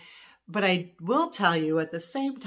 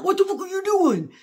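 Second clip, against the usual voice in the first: much higher, louder mock yell; imitating angry ex; 14 semitones higher, 8 dB louder than usual. Mimicking ex-husband reacting to a bang, mock yell. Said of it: "that was how he reacted".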